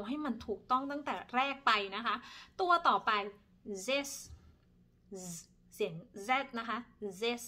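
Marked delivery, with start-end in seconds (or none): 3.8-7.4 zes with a buzzing z; Dutch zes. Shown as a demonstration. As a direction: start zes on a voiced z. (ออกเสียง zes ด้วยเสียง z)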